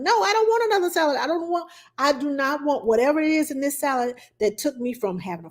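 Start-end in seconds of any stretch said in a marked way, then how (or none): 0.0-4.2 high-pitched, hurried protest that then slows; indignant refusal. Retold or imitated. Guest turning down friend's second salad, indignant refusal.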